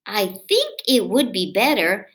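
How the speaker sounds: put-on lively read-aloud for kids; storybook reading. A narrator's reading. Reading a picture book aloud to children, lively.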